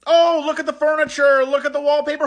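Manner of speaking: hammed-up twee museum docent; mocking chintzy tour voice. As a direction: gush over furniture in a mocking high voice. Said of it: "All of this chintzy".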